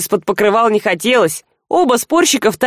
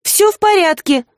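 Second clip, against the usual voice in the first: shaky, unconvincing; 9 semitones higher than usual. A narrator's reading. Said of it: "дрожащим, исключительно неубедительным голосом".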